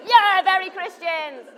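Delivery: high, loud, slow delighted cry; delighted exclaim. Retold or imitated. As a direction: draw it out in delight. Their delighted echo.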